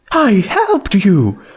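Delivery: slow, high, pointed reminder. Acted. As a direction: stress each word pointedly.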